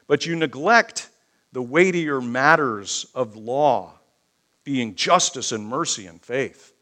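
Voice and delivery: loud, emphatic scriptural rebuke; full-force preaching. Acted. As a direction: drive each phrase home to the congregation.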